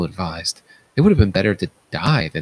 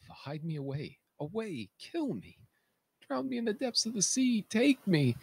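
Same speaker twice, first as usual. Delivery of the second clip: soft but climbing higher in despair; soft, ever higher pleading; desperate high plea; 13 semitones higher, 12 dB quieter than usual. Acted. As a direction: rise to a thin high desperate cry. King begs for death.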